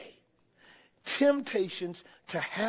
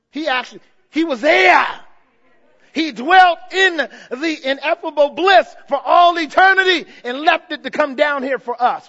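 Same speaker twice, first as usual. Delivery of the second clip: high, loud sermon climax; exultant pulpit shout; full-force preaching; 6 semitones higher, 11 dB louder than usual. Acted. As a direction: preach it out loud to the whole church.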